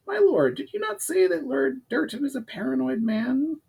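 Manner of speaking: high, pinched goblin adviser; high-voiced goblin laying out the problem. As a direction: pitch it up like a scheming goblin. Goblin Urgar excited.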